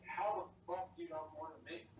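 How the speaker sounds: ordinary delivery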